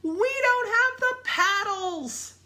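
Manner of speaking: high, loud, drawn-out wail; alarmed cry. A narrator's reading. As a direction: wail the problem out slowly. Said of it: "cried Jack".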